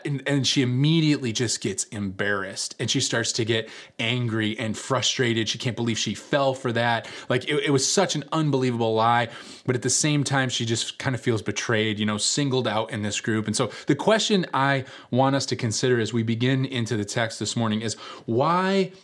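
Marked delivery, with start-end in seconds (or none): none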